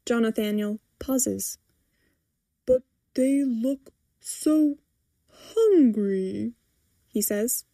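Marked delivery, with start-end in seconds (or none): 2.6-6.5 slow, lightly voiced big softie; mild pleading. A narrator's reading. Man pleading to feed the fish, slowly.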